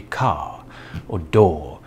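car said cah, no R at the end; car and door with dropped final R, British style; non-rhotic British. Shown as a demonstration. British car and door, R left off. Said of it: "people do not pronounce their R's at the end of words".

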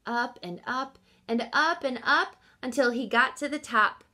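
ordinary delivery

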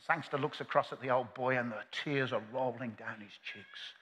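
ordinary talk, nothing marked

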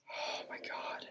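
very soft, voiceless whisper; hushed recovery after a fright; shaken whisper. Real, not acted. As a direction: whisper it under your breath.

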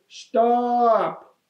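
very high, stretched-out yell; child's acted all-out protest; storybook yell. A narrator's reading. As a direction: yell it high without full volume. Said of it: "yelled as loudly as she could".

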